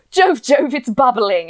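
loud, high excited cry; mock shout. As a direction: call it out over the bubbling metal. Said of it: "Amber shouted".

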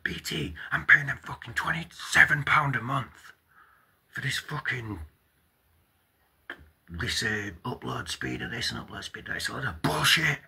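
high, heated rant; broadband rant. Real, not acted. Ranting to viewers about paying BT for bad internet, heated. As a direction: vent about the bill, climbing high.

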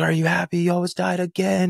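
high-pitched, upset mom's reproach; imitated crying mother. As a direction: mimic a tearful mother scolding her son, without sobbing. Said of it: "she's crying".

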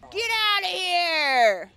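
high, loud, drawn-out yell; slow battle yell. Acted. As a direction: roar them away. Fighting off aliens.

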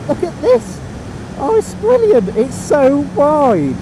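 very high, loud, thrilled exclamations; excited marvelling. Real, not acted. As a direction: gush in a high voice, stretching the last words. Thrilled at the road.

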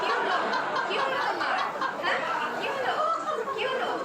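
high-pitched, repeated anxious questions; alarmed. Acted. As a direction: ask over and over in alarm. Wife startled by sleeper's outburst. (ঘাবড়ে গিয়ে চড়া গলায়)

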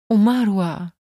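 heavy, mildly sorrowful word; sad. A narrator's reading. Count confirming the death to his wife, sad. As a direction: say it with understated grief. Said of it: "powiedział smutnym głosem Gwidon".

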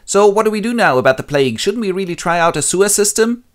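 high, loud and quick pleading; the townsfolk nagging their king; chorus voice. Acted. Chorus pestering Oedipus about the plague, high and loud. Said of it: "So the chorus goes".

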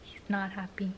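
breathy whispered aside. Real, not acted. Owner whispers about her upset dog, hushed whisper. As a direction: whisper it so the dog won't hear.

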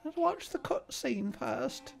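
high, prim little butler voice; Cecil's voice. Acted. Fussy butler greets his master, high voice. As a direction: chirp it up as the fussy servant.

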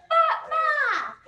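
"Battement" called out high, loud and slow; drawn-out teaching call. Shown as a demonstration. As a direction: stretch "Battement" out for kids to repeat. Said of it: "Can we say".

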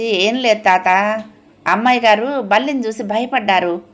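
usual speaking voice

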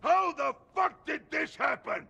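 high, loud enraged yell; furious shout. Acted. Boss yelling at the newbie about the wreckage, furious shout. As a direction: bellow at the mess in disbelief.

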